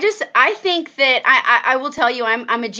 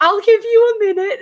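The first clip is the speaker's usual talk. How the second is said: higher, wary; mock tactful husband; lightly voiced husband giving her space; husband backing off; 9 semitones higher than usual. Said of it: "he's like".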